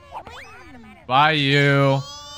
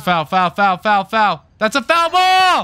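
loud, rising chant of foul; excited foul call; 7 semitones higher, 7 dB louder than usual. Real, not acted. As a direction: hammer the word foul with relief. Relieved repeated foul call.

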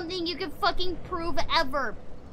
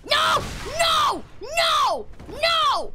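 high, loud repeated shouts; shouted protest in the heat of play; yelling; 9 semitones higher, 3 dB louder, much slower than usual. Real, not acted. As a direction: yell each no as its own high cry. Yelling during game fight.

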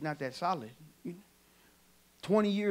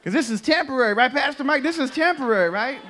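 higher, louder preacher's cry to the pastor; preaching; 7 semitones higher, 9 dB louder than usual. Acted. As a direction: lift it like a sermon, calling on Pastor Mike. Rises into preaching to Pastor Mike.

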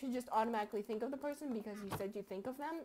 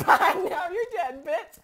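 very loud, slow and higher; gloating yell; 5 semitones higher, 15 dB louder, much slower than usual. Real, not acted. Player taunting a killed opponent, gloating yell. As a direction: bellow it in triumph at a victim. Gloating over a game kill.

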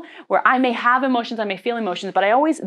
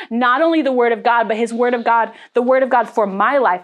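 ordinary delivery

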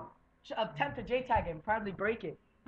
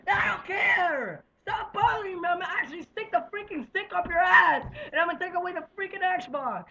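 loud furious yell; furious shouting; 6 semitones higher, 7 dB louder than usual. Real, not acted. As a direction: scream at brother to stop. Yelling at brother to stop.

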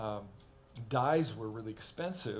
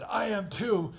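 put-on louder, defiant kid; mock defiant shout; 8 semitones higher, 7 dB louder, slower than usual. Little brother defying the narrator, mock shout.

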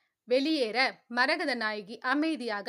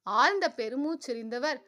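usual speaking voice

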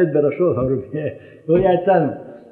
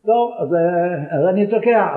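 ordinary delivery

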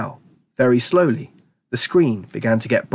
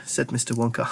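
ordinary delivery

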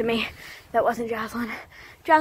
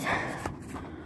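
heavy exhale; 13 dB quieter than usual. Real, not acted. Winded between sprint runs.

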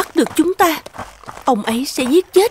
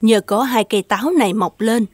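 story narration; 9 semitones lower than usual. A narrator's reading. The narrator tells the listeners how the tale ends, low and calm.

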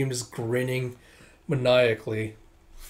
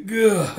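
higher, louder imitated grunt; drunk's groan; 7 semitones higher, 5 dB louder than usual. Drunk guy staggering to his feet, groaning.

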